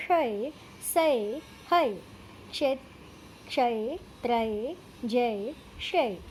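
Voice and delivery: each syllable said slowly on its own, for pupils to repeat; teacher reciting the letter sounds one by one; slow drill recitation. Shown as a demonstration.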